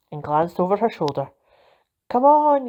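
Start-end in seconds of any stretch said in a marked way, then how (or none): 2.1-2.7 loud eager call to friends; yelling. A narrator's reading. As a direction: shout it out to rally them. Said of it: "yelled Peggy".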